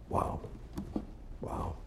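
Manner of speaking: ordinary talk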